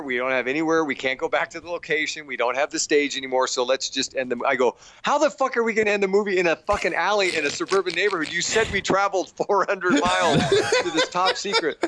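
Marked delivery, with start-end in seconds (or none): 5.0-11.8 higher, quick bewildered protest; rapid-fire disbelief at the ending. Retold or imitated. Arguing with the director about the alley ending, bewildered. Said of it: "constant arguments or bewilderment".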